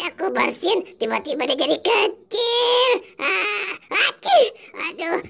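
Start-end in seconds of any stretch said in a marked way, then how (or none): 4.1-5.2 high sneeze then a groan. Acted. Doraemon sneezing while explaining to friends. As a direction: sneeze and moan at once. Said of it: "tuh kan aku bersin lagi nih".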